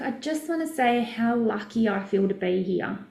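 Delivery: ordinary delivery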